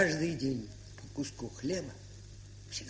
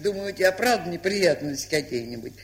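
ordinary talk, nothing marked

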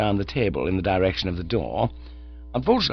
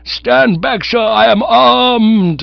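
higher, loud warning shout; 12 semitones higher, 10 dB louder, much slower than usual. Acted. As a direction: bellow a warning brandishing an umbrella. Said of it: "he shouted".

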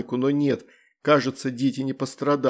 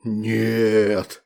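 demon voice; 4 semitones lower than usual. A narrator's reading. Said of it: "сказал демон".

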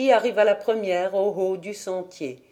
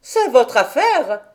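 louder, higher curt retort; sharp retort; 5 semitones higher, 6 dB louder than usual. A narrator's reading. Dete retorts to the uncle, louder and higher. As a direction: snap back at the old man. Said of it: "répliqua Dete".

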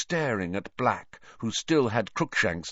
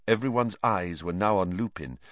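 usual speaking voice

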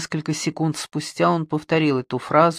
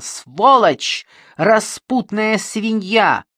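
loud, slow, higher insults; hysterical cursing; 6 semitones higher, 5 dB louder, much slower than usual. Acted. Suspect cursing the woman, hysterical shouting. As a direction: hurl the curses in hysteria. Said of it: "истерически закричал Бэнксон".

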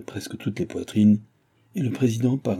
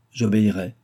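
ordinary delivery, nothing marked

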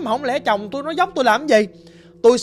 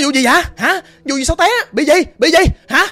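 panicked questions; 5 semitones higher, 5 dB louder than usual. Retold or imitated. Vợ hoảng hốt hỏi chồng.

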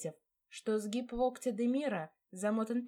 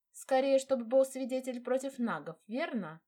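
wry; 6 semitones higher, 4 dB louder than usual. Acted. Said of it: "усмехнулась в ответ".

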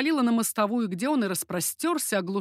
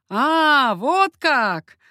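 raised, mocking outburst; loud exclamation; 5 semitones higher, 9 dB louder, much slower than usual. A narrator's reading. Coconnas answering the pleading mother, exclaiming. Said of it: "воскликнул Коканнас и расхохотался".

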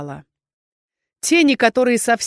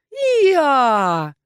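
higher, louder hee-haw bray; donkey bray; 7 semitones higher, 5 dB louder than usual. A narrator's reading. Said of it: "повторил".

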